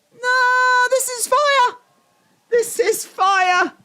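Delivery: high, excited outburst; hyped exclamation. Real, not acted. Viewer reacting to the show, hyped and high. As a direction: cry out high, stretching the last words.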